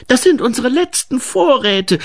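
hoher, protestierender Ausruf; gerufen. A narrator's reading. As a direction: ruf es hoch und alarmiert dazwischen. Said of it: "rief Grete". (hoch gerufener Einwand)

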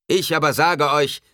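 high, loud, forceful declaration; loud proclamation. A narrator's reading. Preacher contradicts the believers, loud proclamation. Preacher rebukes crowd at full voice.

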